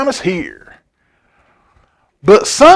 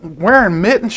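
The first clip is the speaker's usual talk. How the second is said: ordinary delivery, nothing marked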